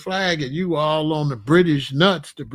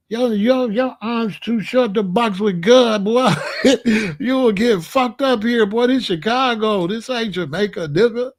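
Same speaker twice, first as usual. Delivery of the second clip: mock threat; 4 semitones higher, 3 dB louder than usual.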